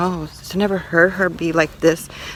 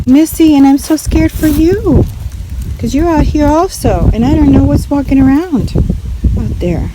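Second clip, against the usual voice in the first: high, loud anxious appeal; worried pleading; 7 semitones higher, 6 dB louder than usual. Real, not acted. Telling her dog Missy she fears for her, loud and high. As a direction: fret aloud to the dog.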